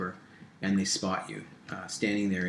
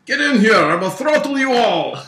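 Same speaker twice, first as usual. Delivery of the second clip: gurgling Russian boss; 14 semitones higher, 14 dB louder than usual. Acted. Boss ordering hesitant henchmen inside, gurgling Russian yell. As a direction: roar a threat in a gurgly Russian accent. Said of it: "electronic-y gurgling voice with a Russian accent".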